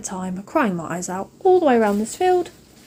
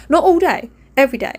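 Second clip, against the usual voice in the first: louder, higher burst of frustration; exasperated outburst; 4 semitones higher, 5 dB louder, faster than usual. Real, not acted. Fed-up mum venting about her kids, raised exasperated voice. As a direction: throw the words out in exasperation.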